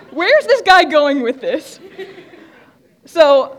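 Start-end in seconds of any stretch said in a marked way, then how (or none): 0.1-1.8 laughing, loud and high; laughing disbelief. Real, not acted. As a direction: laugh through the words, baffled. Amused disbelief at odd claim.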